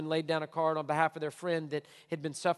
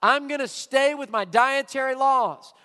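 loud, higher refusal, slow on the laws; preacher acting Peter refusing God; stubborn Peter; 6 semitones higher, 8 dB louder, slower than usual. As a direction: dig in your heels as Peter. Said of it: "And he says".

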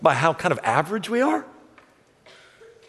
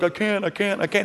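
mock protesting; 7 semitones higher than usual. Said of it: "God finally gets frustrated with him".

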